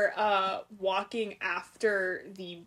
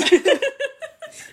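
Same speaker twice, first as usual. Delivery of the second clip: high laugh; 8 dB louder than usual. Real, not acted. Laughing at the joke.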